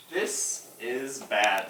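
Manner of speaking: slow, low, soft realisation; dejected little bird. A narrator's reading. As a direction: say it slowly, sinking with dismay. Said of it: "he said".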